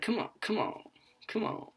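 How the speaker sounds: high, soft mock urging; mock impatient. Cashier mocking pushy customers, a soft mock hurry-up. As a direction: hurry them along, playfully.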